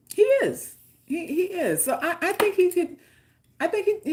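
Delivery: ordinary talk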